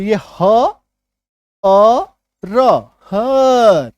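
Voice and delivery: हर drawn out sound by sound, ह, अ, र, then joined; teacher slowly spelling out हर by sound. Shown as a demonstration. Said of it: "इसका उच्चारण देखो यहाँ पे क्या आ गया".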